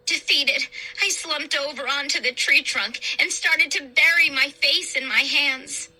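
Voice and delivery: ordinary delivery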